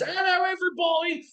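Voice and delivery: high, hammed-up hello to grab the kids; cartoonish high-pitched hello; silly greeting voice. Shown as a demonstration. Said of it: "we do voices, we do accents".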